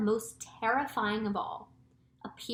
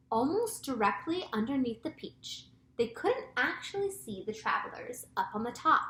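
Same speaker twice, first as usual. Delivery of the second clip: ordinary delivery, nothing marked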